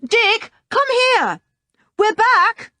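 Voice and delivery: slow, loud call into the dark. Acted. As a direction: shout for him to come. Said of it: "yelled Julian in alarm".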